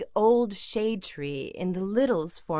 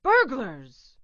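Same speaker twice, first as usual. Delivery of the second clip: Papa's cry; 4 semitones higher, 4 dB louder than usual. A narrator's reading. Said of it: "cried Papa Little".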